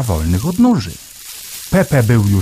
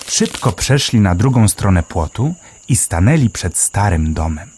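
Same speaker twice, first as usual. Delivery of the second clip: ordinary delivery, nothing marked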